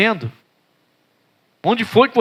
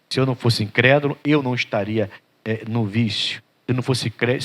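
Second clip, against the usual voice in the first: tearful plea; 7 semitones lower, 4 dB quieter than usual. Acted. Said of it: "chora, clamando".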